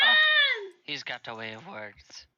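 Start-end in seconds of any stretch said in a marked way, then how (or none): 0.0-0.7 sudden high, loud "oh"; surprised shriek. Real, not acted. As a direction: yelp in shock. Reacting to the Birdman shout.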